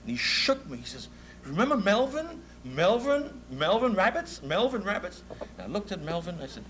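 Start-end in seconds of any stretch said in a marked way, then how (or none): 1.4-5.2 rising again and again, much higher, pressing him to remember; eager insistent questions. Retold or imitated. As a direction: keep asking hopefully, pitch up high.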